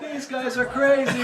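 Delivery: high, slow put-on voice; imitated amazed onlookers. Onlookers reacting to take after take, high awed mimicry. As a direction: mimic awestruck guys watching. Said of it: "and they were like".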